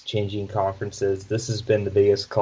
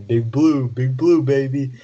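imitating an excited sportscaster, higher and louder; Dick Vitale impression; 5 semitones higher, 5 dB louder than usual. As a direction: punch it out as a hyped-up catchphrase. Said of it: "he always loves saying".

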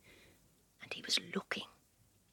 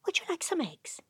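high sweet mother voice; mother's voice; 11 semitones higher, 4 dB quieter than usual. Acted. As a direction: ask gently like a mother. Mother answering creature.

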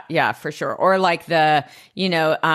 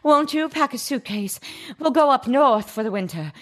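put-on high, lilting old-Hollywood starlet; Lily as a forties movie heroine begging Rufus to run away, all swoon; 1940s movie star; 7 semitones higher than usual. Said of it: "nineteen forties movies vibes".

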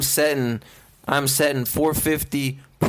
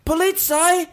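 mock British grocer, pitched well up and louder; British shopkeeper; 12 semitones higher, 3 dB louder than usual. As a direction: threaten the shoplifter in a goofy British voice. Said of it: "a British man that works at a grocery store".

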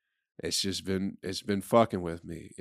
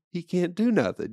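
he can't do nothing pushed up high; hammed-up insistent repeat; mock instructor, louder and higher; 10 semitones higher, 4 dB louder than usual. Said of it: "They go".

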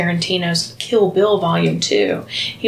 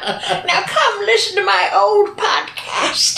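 put-on old lady voice; 11 semitones higher, slower than usual. Imitating old person at eighty.

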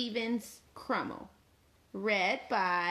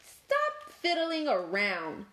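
demanding Cat; 6 semitones higher than usual. Acted. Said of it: "Cat demanded".

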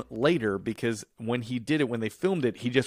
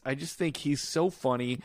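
normal talking voice

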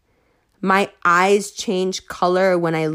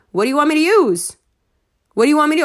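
fast, louder demand; exasperated; 5 semitones higher, 4 dB louder, much faster than usual. Real, not acted. Snapping back at critics, fast and loud. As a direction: fire the question back quickly.

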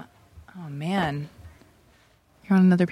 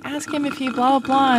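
higher-pitched mock demand, rising in volume; imagined girlfriend; 6 semitones higher than usual. Host imagining the girlfriend's submitted questions, higher mock demand. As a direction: put on a pushy girlfriend's questions. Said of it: "I thought she was gonna be like".

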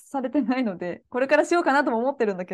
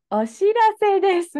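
"お知らせです" pitched up, slow and loud like a formal notice; stiff announcement voice; 8 semitones higher, 6 dB louder, slower than usual. Retold or imitated. Showing how their English ad sounded to him, stiff announcer voice. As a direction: read it like a rigid public announcement. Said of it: "日本語で言ったらこんな感じ".

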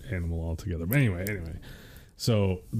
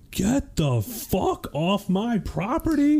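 higher, slow yelled order; retold yell; 11 semitones higher, slower than usual. Husband retelling yelling at intruder, slow and raised. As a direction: yell the intruder off the land, stretched out. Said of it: "but I just was like".

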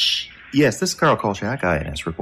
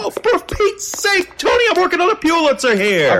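much higher, louder, slowed outraged yelp; exasperated squeal; 6 dB louder than usual. Acted. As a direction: squeal in indignation at being interrupted. Interrupted by his editor Tony.

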